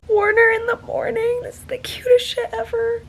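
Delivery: very high, cooing over something adorable; gushing squeal. Real, not acted. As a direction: squeal it as if melting from cuteness. Gushing over cute morning scene.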